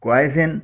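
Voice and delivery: क्वाइजिन said as "KWAI-zin"; wrong-way क्वाइजिन, "kwai-zin"; common mispronunciation. Shown as a demonstration. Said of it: "कोई इसे".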